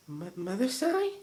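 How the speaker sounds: high, slow, hesitant creature voice; a child daring a new word; shy little changeling. A narrator's reading. Changeling calling the Pegasus mother, high and timid.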